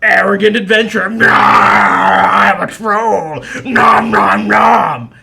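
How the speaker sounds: loud, slow mock snarling troll. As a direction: ham up a savage troll. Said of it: "Oh, you wanted more snarling".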